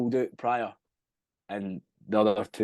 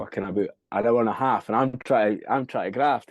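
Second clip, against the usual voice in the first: usual speaking voice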